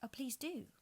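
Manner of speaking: put-on a touch of polite girl; very politely. A narrator's reading. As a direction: agree politely. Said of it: "Alice said very politely".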